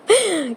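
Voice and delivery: short high-pitched laugh. Real, not acted. Vlogger using humor as a crutch for anger, laugh. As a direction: let out a quick, high burst of laughter. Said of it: "'Cause if I'm not laughing".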